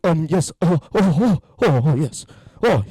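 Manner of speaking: halting, stammering mimicry with a strained voice; mock him fumbling for words; Elon Musk impression. Comedian mocking Elon Musk's halting speech, stammering impression. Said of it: "that's how he sounds".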